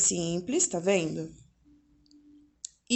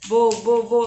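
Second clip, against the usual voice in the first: put-on child sounding out; 9 dB louder, slower than usual. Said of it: "Aí ele ficava".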